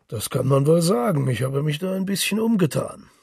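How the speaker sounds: loud, high, jovial journalist back home; hearty boastful Gallagher. Acted. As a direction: brag cheerfully across the bar table. Gallagher brags about Paris.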